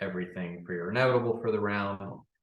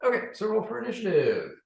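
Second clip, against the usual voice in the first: excited; 13 semitones higher, 5 dB louder than usual. Real, not acted. GM calls for initiative.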